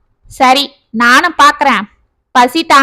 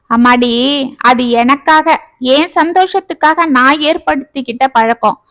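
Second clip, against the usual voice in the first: ordinary delivery, nothing marked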